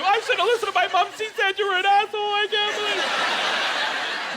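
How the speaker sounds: high, wailing mock-crying wife. Comic as upset wife on Christmas morning, hammed-up crying. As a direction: whine it out as if in tears, drawing out the end. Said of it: "And you are crying, too".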